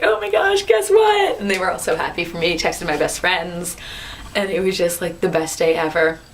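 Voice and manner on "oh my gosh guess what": much higher, eager; can't wait to tell roommates; excited teasing. Retold or imitated.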